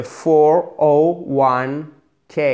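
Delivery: "four oh one K" said one digit at a time, slowly. Shown as a demonstration. Teacher explaining the term, slow and digit by digit. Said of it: "Chiffre par chiffre".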